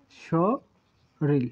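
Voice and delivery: শরীর very slowly as "sho", long pause, "ril"; syllable-by-syllable pronunciation. Shown as a demonstration. Teacher spells out the word learners can write, slowly by syllable. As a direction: split শরীর into two low, widely spaced syllables. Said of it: "শরীরও লিখতে পারেন".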